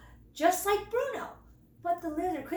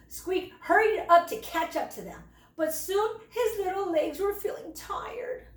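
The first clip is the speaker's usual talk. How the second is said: ordinary delivery, nothing marked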